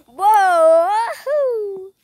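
loud joyful ride yell; excited whoop. Real, not acted. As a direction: whoop with delight. Riding spinning ride, yelling.

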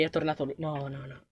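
whispered-down dread; fading dread. Real, not acted. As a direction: sink into a frightened murmur. Hiding from returning monster.

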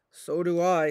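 big wolf bracing himself. Acted. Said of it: "said Big Wolf".

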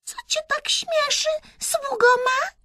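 very high, squeaky haughty princess; Myszka, haughty. A narrator's reading. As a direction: squeak it down at a lowly servant. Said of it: "spytała wyniośle Myszka".

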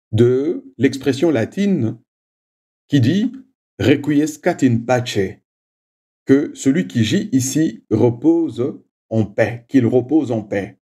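normal talking voice

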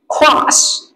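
British "class." with a long open ah vowel; British English. Shown as a demonstration. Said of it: "Anh Anh".

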